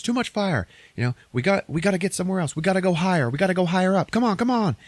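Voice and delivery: high, hurried hero voice; acted movie urgency; urgent urging. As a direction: urge her onward, pitched well up. Hero urging escape in retold film.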